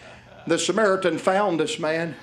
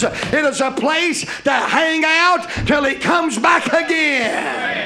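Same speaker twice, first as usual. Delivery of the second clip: loud, rhythmic preacher's cadence rising to a cry; full-force preaching; 7 semitones higher, 4 dB louder, slower than usual. Acted. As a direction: preach it out to the whole church.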